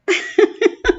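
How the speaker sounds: a high nervous laugh; quick high laugh. Real, not acted. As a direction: laugh up high, half overwhelmed. Laughs about daughter's birthday.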